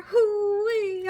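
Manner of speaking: high, loud hoo-wee whoop; excited whoop. Real, not acted. Cook hyping up the dish to viewers, loud excited whoop. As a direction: whoop with delight at the food.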